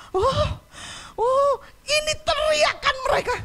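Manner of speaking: anguished exclamation. Real, not acted. Preacher moved by their cries.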